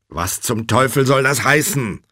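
loud, high indignant demand; irritated phone outburst; exasperated. A narrator's reading.

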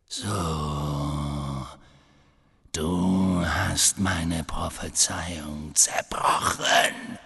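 low, slow, breath-filled menace; Voldemort, quietly. A narrator's reading. Dark lord confronts Harry over the prophecy, quiet menace. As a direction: draw it out softly, deep in the voice. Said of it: "sagte Voldemort leise".

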